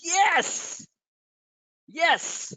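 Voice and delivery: high, exultant yes yes; triumphant cheer. Retold or imitated. Soldier celebrating a hit, high exultant cheering. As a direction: cheer like you just won. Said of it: "It's like".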